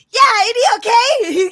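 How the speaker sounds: put-on high, loud yelling boy; yelling kid voice. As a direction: yell it like a hyper kid sidekick. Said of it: "All he does is yell".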